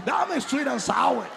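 mock preacher shout; shouted demand. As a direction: proclaim it like scripture.

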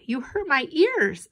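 high-pitched plaintive protest; complaining. A narrator's reading. Brother covering his ears, complaining. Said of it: "said Brian again, covering his ears".

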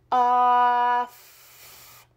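"Off" stretched out slowly, sound by sound; sounding out words. Shown as a demonstration. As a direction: hold each sound of "Off" without stopping. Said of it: "Now you're gonna sound out the word".

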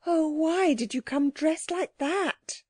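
high, drawn-out plaintive question; despairing. A narrator's reading. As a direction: lament to the visitor, slowly. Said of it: "in tones of despair".

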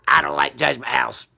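very low, grumbling grump; grumpy voice. Acted. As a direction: grumble like a sulky old toy. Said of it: "Grumpio, you wanna go?".

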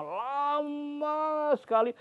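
lama sekali stretched high and slow; drawn-out emphasis. A narrator's reading. Storyteller to children, lama sekali long and high. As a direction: draw out lama sekali slowly.

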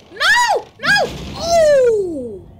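loud high panicked cries; panicked screams. Real, not acted. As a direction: scream while fleeing. Being chased in game.

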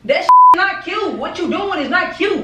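loud, slow, punched scolding; angry shout. Real, not acted. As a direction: yell it, spacing each word. Shouting at girlfriend over prank.

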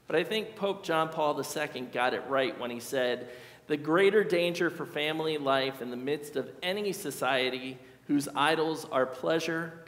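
ordinary delivery

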